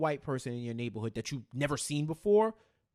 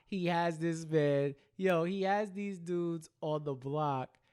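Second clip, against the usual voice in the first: usual speaking voice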